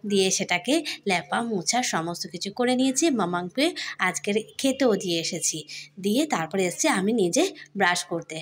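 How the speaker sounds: normal talking voice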